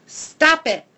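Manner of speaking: high, loud sharp rebuke. A narrator's reading. Hattie scolding her jumping dog, sharp and loud. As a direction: snap it to cut the dog off. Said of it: "she says in a sharp voice".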